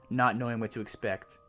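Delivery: usual speaking voice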